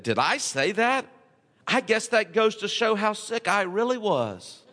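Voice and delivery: a laugh on the excuse, lightly played; mild laughing dodge; sheepish chuckle. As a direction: shrug it off with a mock laugh. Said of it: "This is how the man replied".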